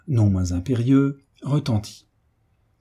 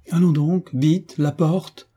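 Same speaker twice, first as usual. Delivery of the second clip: higher-pitched brusque order, a touch impatient; brusque command; 7 semitones higher, slower than usual. A narrator's reading. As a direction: order the door opened, abruptly and higher. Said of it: "dit brusquement le géant galonné". (ordre brusque, voix plus haute)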